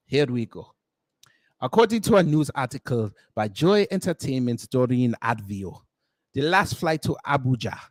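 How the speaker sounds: reading the news in a put-on African accent; light put-on African accent, rolled R's. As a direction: read it like a Nollywood newscaster with an African accent. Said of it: "we will do it in the African accent".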